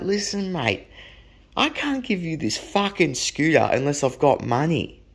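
mildly angry retold warning; firm, restrained re-enacted anger; getting angry. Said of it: "I started getting really angry".

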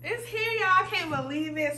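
high-pitched, emotional excitement; overjoyed disbelief. Real, not acted. Vlogger showing viewers the arrived package, overjoyed. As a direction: say it high, barely believing it.

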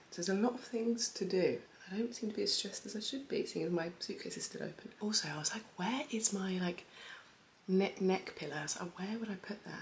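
quiet early-morning murmur; talking softly beside a sleeping partner; hushed morning voice. Real, not acted. Partner asleep in the bed.

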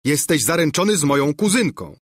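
mild mock yell. A narrator's reading. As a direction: throw the accusation at the rival. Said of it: "wrzasnął Lulejka".

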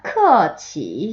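크어치 very slow, each tone stretched out; tone drill. Shown as a demonstration. Teacher starting tone practice, 크어치 slow and drawn out. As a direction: draw out both tones of 크어치. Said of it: "성조 연습 먼저 해볼게요".

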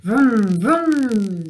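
loud revving vroom; loud falling engine vroom; truck vroom. A narrator's reading. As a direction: roar it like an engine. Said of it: "roars a truck".

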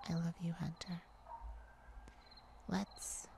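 whispered confession; tender whisper. Acted. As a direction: breathe it softly to him. Confessing love to Hunter.

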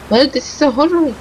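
ordinary talk

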